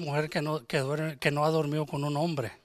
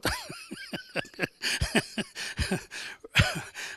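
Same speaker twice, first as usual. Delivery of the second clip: real laugh; 5 dB quieter than usual. Real, not acted. Laughing after his point.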